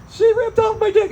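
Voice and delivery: high mock whine; high squeaky guy voice. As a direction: squeal it like an upset dude. Angry guy quoted at show.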